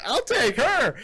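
high-pitched imitation of an eager man; high, acted-out interjection; mock eager offer. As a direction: pipe up high, acting it out. Acts out the man's eager reply.

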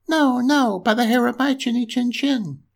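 high-pitched piggy refusal; defiant little pig. A narrator's reading.

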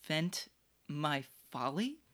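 quiet scoffing repeat of "Vent my folly"; quietly mock the word "Vent"; mocking echo.

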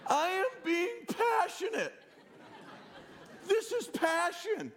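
hammed-up husband shouting back; passionate yelling. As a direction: insist you're not yelling while yelling. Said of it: "Stop yelling at me".

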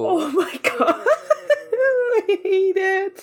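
laughing. Real, not acted. Laughing reaction.

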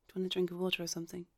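ordinary talk

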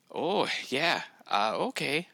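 put-on high, sing-song Minnesota accent; full-on Minnesota accent. Imitating wife's friend with a full-on Minnesota accent. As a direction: lay the Minnesota accent on thick. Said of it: "Minnesota accent, right? Like, just like".

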